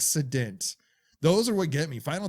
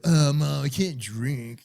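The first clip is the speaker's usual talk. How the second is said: hammed-up, louder "Oh, Mom" like a soap-opera teen; cliché teen line; 2 semitones higher, 5 dB louder, slower than usual. Teen protesting to mom, mock cliché whine. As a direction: overplay it as the corny movie version. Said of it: "not the cliche way of".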